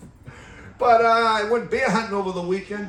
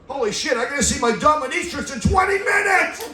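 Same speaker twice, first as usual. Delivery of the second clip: loud and higher, suddenly alarmed; panicked shout; 9 semitones higher, 5 dB louder than usual. Acted. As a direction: blurt it out in a panic. Sudden panicked outburst punchline.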